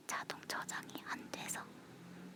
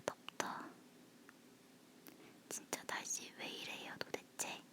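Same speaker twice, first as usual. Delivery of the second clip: breathy whisper close to the mic. Real, not acted. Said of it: "속닥거리는 목소리라서".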